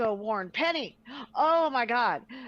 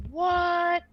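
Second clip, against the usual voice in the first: shocked what; 6 semitones higher, 5 dB louder than usual. Retold or imitated. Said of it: "And I'm like".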